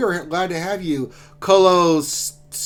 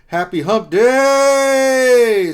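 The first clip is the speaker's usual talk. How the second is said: higher, louder wrestling announcer bellow, drawn out very slow; booming pro wrestling announcer; WWE announcer; 3 semitones higher, 10 dB louder, much slower than usual. Retold or imitated. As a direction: stretch out happy hump day like a ring announcer hyping the crowd. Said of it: "Said that like the W W E announcer".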